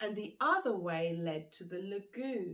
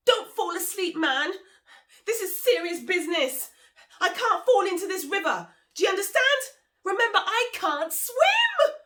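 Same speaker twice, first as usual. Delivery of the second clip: much higher, louder, frightened pleading; panicked pleading; 12 semitones higher, 16 dB louder than usual. Acted. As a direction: beg him not to drop you.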